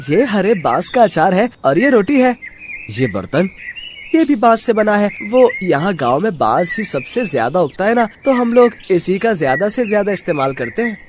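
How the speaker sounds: high, bright-voiced village child. Village boy explaining to Satyabhan, in a child's voice. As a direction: answer like an eager little kid.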